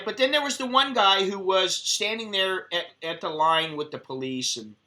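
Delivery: usual speaking voice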